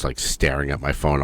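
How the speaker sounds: ordinary talk, nothing marked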